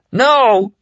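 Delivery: high, loud yelled no. A narrator's reading. Larry yelling as he grabs for Stu's bottle. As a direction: shout it out to stop him. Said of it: "he yelled".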